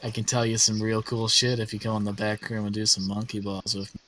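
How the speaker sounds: low-voiced sleazy invitation; Prospero, sleazy schemer. Schemer inviting companions to the back room, low sleazy voice. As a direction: proposition them in a low, oily voice. Game master plays Prospero.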